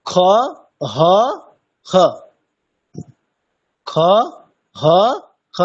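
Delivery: খ and হ alternated to show the mix; kha between ha and kha. Shown as a demonstration. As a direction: blend খ and হ. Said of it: "দেখুন". (খ ও হ-এর মাঝামাঝি উচ্চারণ)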